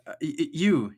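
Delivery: put-on nervous girl stammer.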